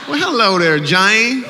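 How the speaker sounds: high, loud, laughing hello; flirty greeting. Acted. Comic hamming up a flirty hello to Jane in the crowd. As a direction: lay on the charm, laughing.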